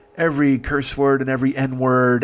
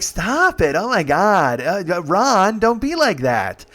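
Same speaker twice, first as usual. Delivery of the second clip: higher-pitched mock protest; loud scolding; 6 semitones higher, 4 dB louder, slower than usual. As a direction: imitate a noisy friend scolding playfully. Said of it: "they're being loud".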